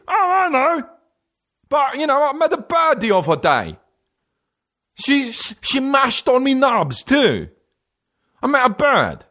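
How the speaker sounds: very high, hammed-up fake Jamaican accent; absurdly high parody of a Jamaican accent; put-on Jamaican-style accent. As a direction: ham up a squealing mock Jamaican accent. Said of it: "started talking like this for no reason".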